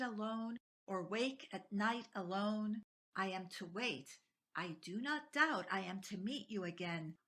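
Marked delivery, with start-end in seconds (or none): none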